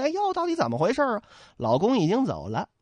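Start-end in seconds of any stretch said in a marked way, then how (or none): 0.0-1.2 flustered wife. Acted. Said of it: "慌忙出来问".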